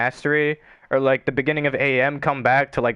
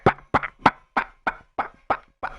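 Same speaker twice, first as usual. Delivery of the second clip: mock smacking noises; faster than usual. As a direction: voice the bat hits as quick puffs. Imitating smacking with a bat.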